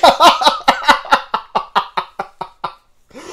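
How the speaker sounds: high, loud laughing question. Real, not acted. Laughing at the workload ahead.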